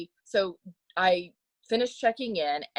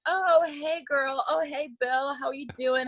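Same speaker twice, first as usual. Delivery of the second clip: mock friendly greetings; 7 semitones higher, 3 dB quieter than usual. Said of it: "he and Joy are like".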